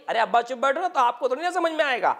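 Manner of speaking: imitating a son cutting off his father; mock dismissive hush. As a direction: rush it out to shut him up. Son silencing father in a meeting.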